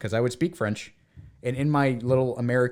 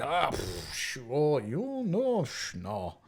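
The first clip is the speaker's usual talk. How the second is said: puffed, hesitant sighing noises with soft drawn-out words; imitating French-speaker sounds; 2 semitones higher, 5 dB quieter, much slower than usual. Showing how French speakers hedge, imitated puffs and sighs. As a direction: trail off through reluctant little puffs. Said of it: "like these little sounds".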